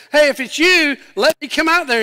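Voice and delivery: loud, high call across distance; Peter calling out. Retold or imitated. Peter calls to Jesus walking on the water, calling out. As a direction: act out an eager shout over the waves.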